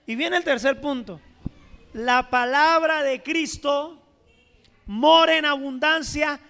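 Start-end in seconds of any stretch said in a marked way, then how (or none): 1.9-6.4 slow, loud proclaimed verse; scripture reading. Acted. Pastor reading Colossians to the church, slow and loud. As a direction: proclaim each word slowly.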